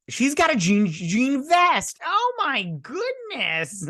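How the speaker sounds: very high, loud squeal of disbelief; exasperated outburst. Real, not acted. Reviewer bothered by a costume detail, exasperated outburst. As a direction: let it climb into a stretched-out, outraged squeal.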